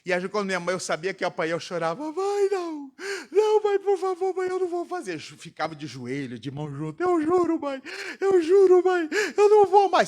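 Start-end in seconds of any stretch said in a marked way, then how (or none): 2.1-5.2 put-on high, pleading child's voice; imitating a frightened boy begging his mother. Child begging mother before beating. 7.0-10.1 put-on high, louder desperate child's plea; imitating a kneeling boy begging his mother, higher and louder; child pleading. Child on knees swearing to mother.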